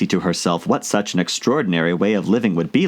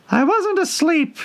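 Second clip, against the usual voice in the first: very high, feeble Dormouse squeak; hoarse feeble. A narrator's reading. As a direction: squeak it out weakly. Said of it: "in a hoarse, feeble voice".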